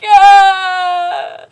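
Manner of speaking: loud high yelp of fright; a hammed-up frightened cry; mock scared shout. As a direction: yelp like a startled anime hero. Reacting to knife emoji.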